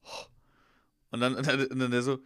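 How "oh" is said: short soft mimed huff; breathing out. Retold or imitated. Colleague told to breathe properly on the officer, soft huff. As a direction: imitate puffing breath at someone. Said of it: "Hauchen Sie mich jetzt mal richtig an".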